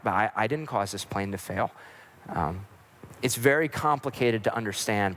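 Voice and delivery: low, measured reassurance; gentle and calm. Acted. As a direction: explain patiently to someone who is panicking. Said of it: "gentle and calm".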